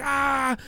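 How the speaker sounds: high, soft imitation of a pained yell; a quick hurt yelp, held back; mock scream. Injured wrestler carried off, mock pained yell. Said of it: "Zack is just screaming".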